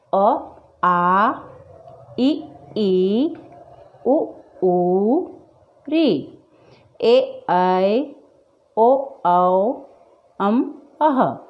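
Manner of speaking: each vowel recited separately, short then long; स्वरों का उच्चारण. Shown as a demonstration. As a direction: recite the vowels one by one, holding the long ones. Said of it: "इनका उच्चारण इस तरीके से". (स्वरों का साफ़, अलग-अलग उच्चारण)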